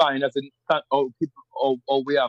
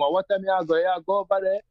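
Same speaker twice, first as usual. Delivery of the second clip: rural Jamaican patois, pitched higher; country Jamaican slang, light and raised; 6 semitones higher than usual. Shown as a demonstration. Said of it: "or someone, or from".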